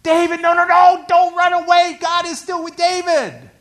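full high-pitched desperate yell. Acted. Reader yelling at David through the pages, full yell. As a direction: yell a plea to someone far off. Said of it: "you can yell at David through the pages".

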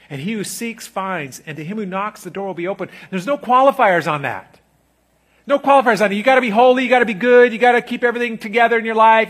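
loud, high revival preaching; fiery sermon climax; preaching at full force. Acted. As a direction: lift the room, preach it out.

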